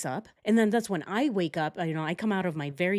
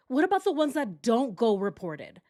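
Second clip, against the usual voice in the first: ordinary delivery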